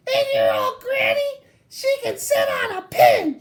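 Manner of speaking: high mocking pig yelling insults. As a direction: taunt the wolf in a high pig voice. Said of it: "third little pig yelled out".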